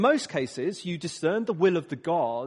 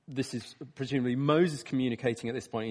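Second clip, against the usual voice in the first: ordinary delivery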